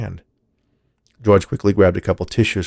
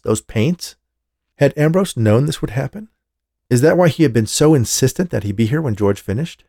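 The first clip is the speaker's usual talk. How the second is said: usual speaking voice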